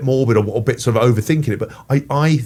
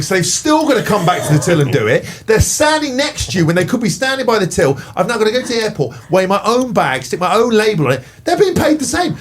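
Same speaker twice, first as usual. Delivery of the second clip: thirty-second rant; 6 semitones higher than usual. Acted. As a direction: vent about self-service checkouts, getting more worked up. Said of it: "We do a thirty-second rant".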